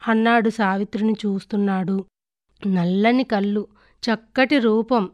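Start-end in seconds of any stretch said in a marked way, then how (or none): none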